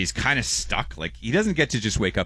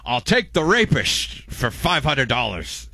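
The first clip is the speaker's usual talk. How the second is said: hammed-up Scottish Connery, much higher and louder, slow; Sean Connery impression; 8 semitones higher, 5 dB louder, slower than usual. Host steals the SNL Jeopardy bit, hammy Scottish Connery voice. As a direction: lean into a bad Scottish drawl, slowly. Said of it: "Sean Connery on, on Jeopardy".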